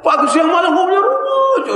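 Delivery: high, held-out teasing whine; exasperated mockery. Preacher teasing house sellers' constant talk, mock exasperation. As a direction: raise it high and hang on the topic.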